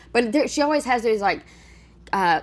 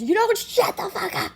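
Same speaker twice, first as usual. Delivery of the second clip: mock furious yell; 13 semitones higher than usual. Imitating the boyfriend yelling at his girlfriend, high mock shout. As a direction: shriek it like you've finally snapped at her. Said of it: "That's what I wanna say to her too".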